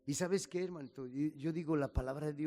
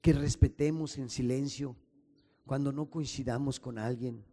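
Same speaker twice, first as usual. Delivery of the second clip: louder, rising pastor's prayer building in force; fervent prayer; 5 dB louder than usual. Acted. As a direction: pray aloud to the Father with growing fervour. Pastor leads closing prayer.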